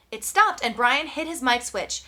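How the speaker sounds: plain reading voice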